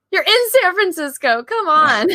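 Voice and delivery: high-pitched incredulous protest; mock-exasperated objection; playful exasperation. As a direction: heckle the film for its obvious mistake. Exasperated heckling of the film.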